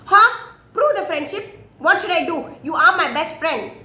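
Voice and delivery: incredulous, eager monkey voice; surprised monkey. Acted.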